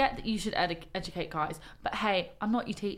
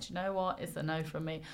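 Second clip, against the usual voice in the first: calm refusal; 3 semitones lower, 7 dB quieter than usual. Retold or imitated. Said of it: "I should have just been like".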